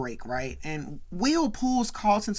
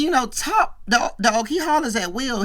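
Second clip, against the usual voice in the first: ordinary talk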